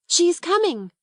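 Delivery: high, happy anticipation; excited announcement. Acted. Sarah tells Ermengarde that Becky is on the way, excited. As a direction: announce an awaited friend eagerly. Sarah hears answering knocks.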